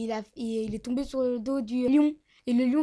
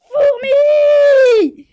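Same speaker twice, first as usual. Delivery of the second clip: even louder, higher yell; bigger shout; 14 semitones higher, 18 dB louder than usual. Acted. Djibril, exasperated, yells at the ant even louder. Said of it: "il s'écrie".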